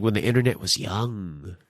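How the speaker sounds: low, slow voice of a temple elder; wise old sage. Pretend. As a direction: intone it like a bearded sage.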